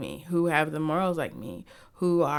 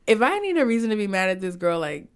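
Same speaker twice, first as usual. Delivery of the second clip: higher, louder mocking voice of online critics, rising to a squeal; voicing the social media backlash, mock outraged; mock outraged hater; 4 semitones higher, 4 dB louder than usual. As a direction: sneer it like an indignant commenter. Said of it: "social media was like".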